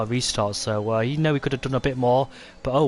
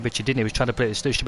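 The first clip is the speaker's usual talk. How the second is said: normal talking voice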